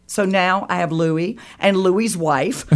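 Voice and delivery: ordinary talk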